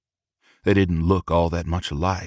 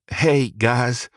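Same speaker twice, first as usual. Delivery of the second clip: disappointed; 4 semitones higher than usual. A narrator's reading. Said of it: "disappointed in himself".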